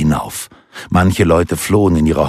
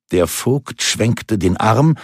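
usual speaking voice

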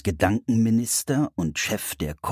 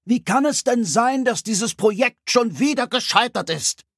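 higher, biting questions; sharp critic; 4 dB louder than usual. Acted. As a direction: cut in sharply. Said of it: "fragte der innere Kritiker scharf".

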